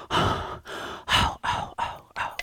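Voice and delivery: breathy, unvoiced little sighs; sighing moans. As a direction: sigh over the gripping story. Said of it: "I'm just going".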